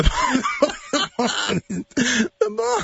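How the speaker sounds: helpless high-pitched laughter through the words; squeaky, broken by laughter; cracking up. Real, not acted. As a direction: try to speak while collapsing with laughter. Cracks up attempting the description.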